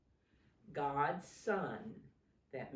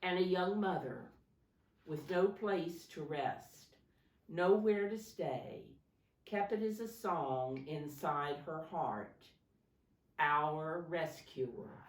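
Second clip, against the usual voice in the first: put-on lively read-aloud to children, a bit louder; dramatic read-aloud for kids; storybook reading; 7 dB louder than usual. A narrator's reading. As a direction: read the Christmas story to little ones with energy. Reading picture book to children.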